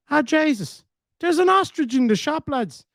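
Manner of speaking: put-on high, excited Dublin accent; a startled Dublin lad blurting it out in a high voice. Dublin guy in the queue spotting the emu, high and amazed in a Dublin accent. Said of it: "he's from Dublin. He goes".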